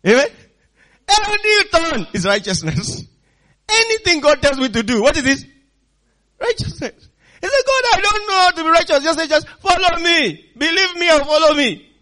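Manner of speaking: high, loud sermon delivery; full-force preaching. Acted. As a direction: belt it out to the whole congregation.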